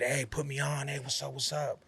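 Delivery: soft, mock hustler's plea; hushed nagging for attention; mimicked pestering. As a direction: imitate someone angling for a favor, under the voice. Mimics pestering he never did.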